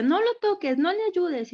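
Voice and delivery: mock a four-year-old ordering others away; imitating her son. Mother retells son's bossy words. (imitando a un niño mandón, más agudo)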